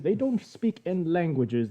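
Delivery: ordinary talk, nothing marked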